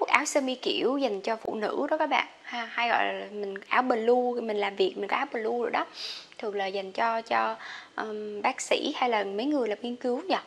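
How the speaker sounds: normal talking voice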